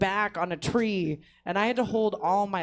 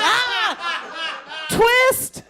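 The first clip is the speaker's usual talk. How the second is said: sudden high loud yelp; startled yelp; 10 semitones higher, 9 dB louder than usual. Retold or imitated. Caught off guard on the walk, startled yelp. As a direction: jump at the voice behind you. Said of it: "And I went".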